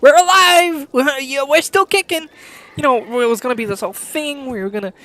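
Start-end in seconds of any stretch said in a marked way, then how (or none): 0.0-2.3 mock cheer. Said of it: "thrust you into the fact that".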